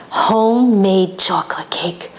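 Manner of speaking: slow whispered temptation; mother luring son with cake; tempting whisper. Retold or imitated. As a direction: whisper it like a secret treat. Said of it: "She says".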